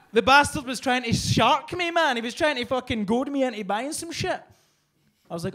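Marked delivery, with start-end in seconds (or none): none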